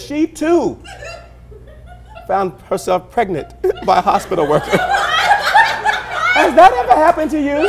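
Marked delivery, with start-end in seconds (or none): 4.8-7.7 high, slow, drawn-out sing-song question; hammed-up teasing catchphrase; mock dramatic host. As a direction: stretch it out like a soap-opera announcer.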